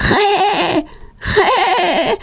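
high squawking jay call; imitated high-pitched bird cry; bird croak. A narrator's reading. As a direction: screech like a jay. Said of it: "ein Krächzen".

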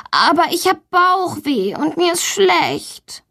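put-on high, whiny child's protest; plaintive, pleading whine; insistent complaint. A narrator's reading. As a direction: insist pitifully that you are ill. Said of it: "behauptet Valentina".

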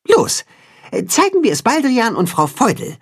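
high, eager instructing voice; rally the friends, high and keen; high cat-hen voice urging friends on; commanding cat-hen. A narrator's reading. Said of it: "weist das Katzenhuhn die Freunde an".

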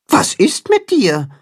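high, concerned bird voice; worried Timme. A narrator's reading. Timme asks the crying carp, high and worried. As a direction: ask gently, worried. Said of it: "fragt Timme besorgt".

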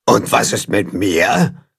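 slow, odd monster voice from behind; shaggy monster asking from behind. Acted. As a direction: creep in slowly like a shaggy monster. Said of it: "kommt eine Stimme von hinten".

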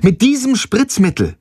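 ein Mädchen ruft Passanten laut zu; hoch gerufener Appell; rufend, höher. Acted. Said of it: "ruft sie". (laut rufen, hohe Stimme)

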